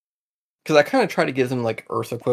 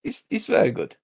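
put-on higher-pitched Russo-German accent; 11 semitones higher than usual. Game master answering player's attempt, in Russo-German accent. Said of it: "As you can tell by my Russo German accent".

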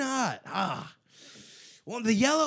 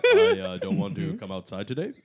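a loose, lightly voiced Obama impression; attempted Obama; 6 semitones lower than usual. A friend attempting an Obama impression, lightly voiced. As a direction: try a halting presidential Obama cadence. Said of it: "I can't do an Obama".

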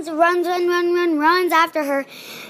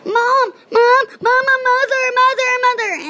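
high, louder calling out; mock scream for mom; retold scream; 8 semitones higher, 5 dB louder than usual. Nancy screaming for her mother through the car window, loud and high. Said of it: "Nancy screams and bangs the window".

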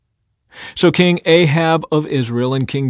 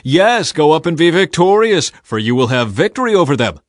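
mocking high loud agreement, rushing the end; a prophet mocking the king with fake agreement; sarcastic; 7 semitones higher, 8 dB louder than usual. A narrator's reading. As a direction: sneer the promise of victory. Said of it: "Micaiah replied sarcastically".

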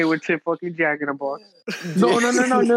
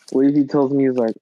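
ordinary talk

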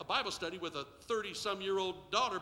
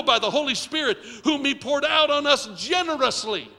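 high, loud, driving sermon delivery; full-force preaching; 6 semitones higher, 12 dB louder than usual. Acted. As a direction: proclaim it with rousing conviction.

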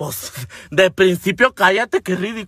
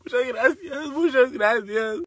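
lloriqueo suave y alargado; agradecimiento lloroso actuado; 4 semitones higher, 4 dB quieter, slower than usual. Retold or imitated. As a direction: agradece como entre lágrimas, exagerando. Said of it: "en ese momento yo sí estaba mal". (gracias llorosas fingidas)

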